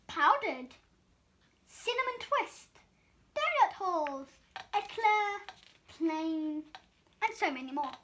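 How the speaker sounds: ordinary delivery, nothing marked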